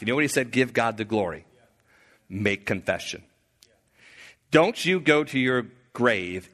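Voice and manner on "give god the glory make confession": Joshua pleading softly, dropping low and quiet; almost a whisper, lower and softer, urging confession; hushed urging. Retold or imitated. Joshua quietly urges Achan to confess, hushed and low.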